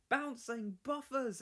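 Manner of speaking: high, slow startled cry; exclaiming. Acted. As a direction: gasp it out in alarm. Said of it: "explained Thomas".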